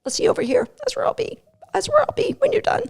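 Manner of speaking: high, soft, sing-song dog voice from off to the side; breezy, drifting away while calling back; dog wandering off. Retold or imitated. Dog tells its handler it's leaving, high and soft.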